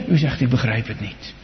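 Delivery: ordinary talk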